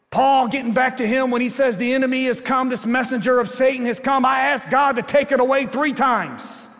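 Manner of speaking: high, sustained pulpit cry; impassioned, projected sermon voice; full-force preaching. Real, not acted.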